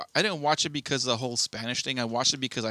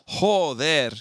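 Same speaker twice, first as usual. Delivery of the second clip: lightly imitated Spanish kid cursing, punched out; Spanish kids' exclamation; 4 semitones higher, 6 dB louder than usual. Quoting what the Spanish kids always said, lightly imitated exclamation. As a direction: punch it out louder, like a kid swearing. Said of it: "one of the things that they would always say".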